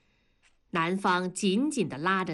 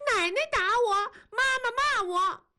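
put-on high wailing little girl; crying child. A narrator's reading. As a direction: bawl it out like a hurt child. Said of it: "哭着大声地说".

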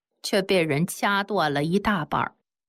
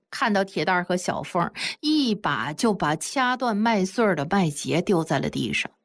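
usual speaking voice

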